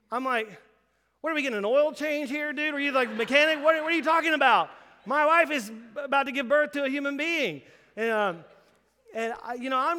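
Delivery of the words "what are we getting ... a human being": loud, high and fast, near shouting; indignant outburst. Retold or imitated. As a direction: scold the casual doctor in disbelief. Angry husband mocks casual doctor.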